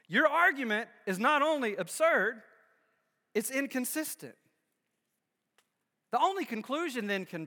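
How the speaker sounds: preacher builds to the climax before his church; fiery preaching. Acted. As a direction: drive the point home to the congregation. Preaches the conclusion at full force.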